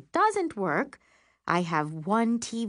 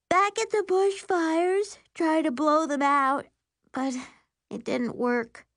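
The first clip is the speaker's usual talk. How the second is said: high-pitched, drawn-out younger-sister voice; yawning sister; 11 semitones higher, 4 dB louder than usual. A narrator's reading. Tired sister explains where she was, high drawn-out voice. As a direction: pitch it up and stretch the words. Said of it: "Miri yawns".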